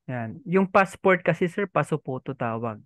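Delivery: ordinary delivery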